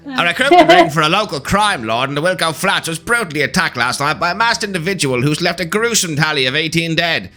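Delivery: 1950s newsreel announcer; radio announcer voice. Acted. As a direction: read it like an old radio bulletin. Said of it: "nineteen fifties radio announcer voice".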